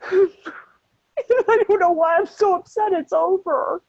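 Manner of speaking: crying through a shaky laugh; half laughing, half sobbing; tearful. Real, not acted. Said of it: "Just let it, let it out".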